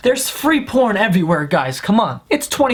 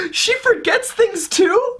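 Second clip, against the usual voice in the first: much higher, slow incredulous squeal; mock disbelief; 13 semitones higher, slower than usual. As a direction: act outraged at something trivial. His mocking reaction.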